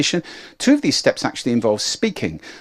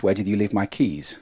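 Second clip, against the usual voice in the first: calm, lower-pitched question; low-pitched, unexcited; 3 semitones lower, 4 dB quieter than usual. Shown as a demonstration. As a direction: ask it low and without excitement. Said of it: "change your excitement just with pitch".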